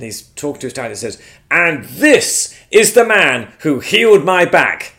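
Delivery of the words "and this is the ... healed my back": slow, louder, higher proud announcement; proud introduction. Retold or imitated. Neighbour telling his dad about the healing, proudly slow and loud. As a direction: announce it with pride to the family.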